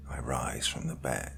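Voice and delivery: soft unvoiced whisper. A narrator's reading. Killer narrator rises from bed, whispering. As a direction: breathe it like a secret. Narrator creeping toward murder.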